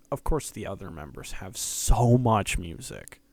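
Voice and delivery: suddenly loud, peaking the mic. Real, not acted. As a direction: burst out loudly on so much. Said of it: "Sorry if that peaked".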